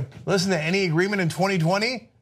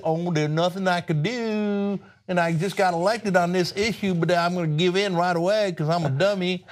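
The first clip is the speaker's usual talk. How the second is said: mock spineless politician; 3 semitones higher than usual. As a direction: play a feeble pushover giving up. Mocks the spineless Democrat governor.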